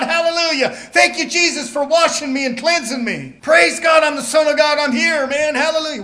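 loud, high, jubilant hallelujah shouting; exuberant shouted praise. Retold or imitated. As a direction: shout it like a worshipper carried away. Lone worshipper shouting praise alone.

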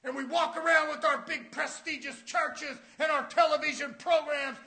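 loud, high fire-and-brimstone preaching; preaching shout. Acted. Preacher shouting to the church about Canaan. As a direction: thunder it at the congregation.

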